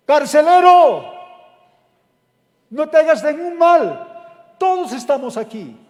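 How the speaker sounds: loud high call to the jailer; Paul shouting to the jailer to stop. Acted. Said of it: "Y Pablo grita".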